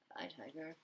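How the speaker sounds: usual speaking voice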